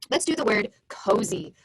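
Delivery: normal talking voice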